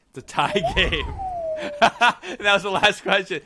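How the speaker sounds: high, loud words broken by laughter; laughing announcement. Real, not acted. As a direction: crack up while announcing the tie. Host laughs at a tie game.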